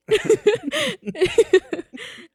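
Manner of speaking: loud, helpless real laughter; high squealing laugh. Real, not acted. Friend shouts out the slipped name, high helpless laughter.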